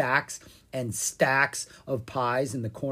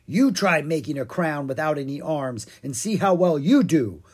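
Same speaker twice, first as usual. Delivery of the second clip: louder, offended huff; huffy; 4 semitones higher, 7 dB louder than usual. A narrator's reading. Pumpkin King defends his homemade crown, huffily. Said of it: "the Pumpkin King huffed".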